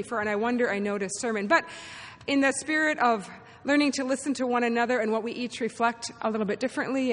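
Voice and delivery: ordinary talk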